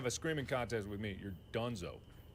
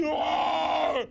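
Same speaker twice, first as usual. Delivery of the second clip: startled scream; 14 semitones higher, 9 dB louder than usual. Real, not acted. Startled by a jump scare.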